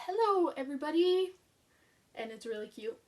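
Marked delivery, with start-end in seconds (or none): 0.1-1.4 higher, chirpy monster greeting; a hint of lovable Grover; light Grover voice. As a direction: greet the reader like furry Grover. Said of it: "he says".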